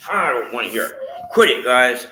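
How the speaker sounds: loud, high scolding shout; angry scolding. Real, not acted. Owner scolding the dogs mid-prayer, angry shout.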